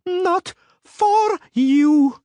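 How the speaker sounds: high, loud, very slow yell; yelled warning. A narrator's reading. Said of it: "Shinats yelled".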